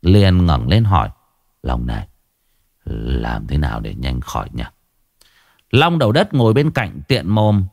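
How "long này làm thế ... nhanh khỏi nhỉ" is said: low, quiet feeble voice; barely has strength to ask; weak sick man. Acted. (giọng ốm yếu, trầm và nhỏ)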